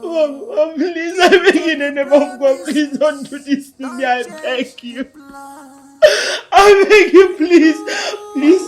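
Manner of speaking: loud, tearful begging that surges into cries; sobbing wail. Acted. Child begging dead mother to stand and drink, wailing. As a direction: beg and wail through heavy sobs.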